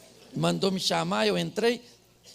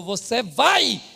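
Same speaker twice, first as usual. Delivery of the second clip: raised, pushy voice of a wife insisting; mock nagging wife, loud and high; insistent wife; 6 semitones higher, 8 dB louder than usual. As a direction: push him to go, louder and higher. Wife insisting he get checked.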